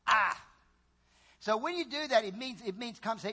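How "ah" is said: groan of dismay. Shown as a demonstration.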